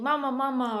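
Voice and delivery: put-on toddler calling mama. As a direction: call out like a toddler left alone.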